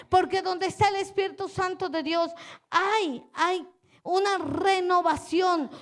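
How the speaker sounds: fiery pulpit declaration; preaching at full force. Acted.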